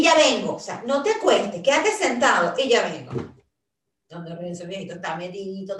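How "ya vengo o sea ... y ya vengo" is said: loud, insistent call to stay put; loud instruction to dad. Retold or imitated. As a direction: call out firmly so dad hears. Telling dad to stay seated.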